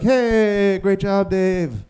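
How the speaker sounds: slow, high, overly supportive praise; mock-supportive group therapy voice; fake encouragement. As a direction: cheer him on with hollow sweetness. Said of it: "And externally, we're like".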